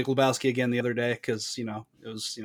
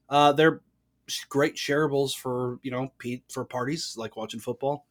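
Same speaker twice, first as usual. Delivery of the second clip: normal talking voice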